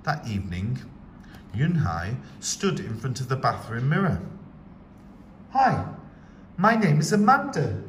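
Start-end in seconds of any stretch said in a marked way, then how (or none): 5.5-7.8 bright, higher, louder greeting; cheerful. A narrator's reading. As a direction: chirp it cheerfully. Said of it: "she said cheerfully".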